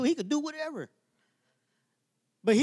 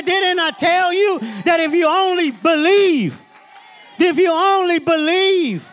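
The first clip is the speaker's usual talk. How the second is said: preaching at full force; 4 semitones higher than usual. Acted. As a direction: preach it hard, pushing the church to endure. Preaching to the congregation.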